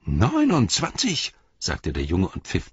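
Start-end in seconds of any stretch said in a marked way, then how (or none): 0.1-1.3 put-on high, slow boyish exclamation; a young boy marvelling; boy's voice. A narrator's reading. Boy marvels at a hobbit's age, high and slow.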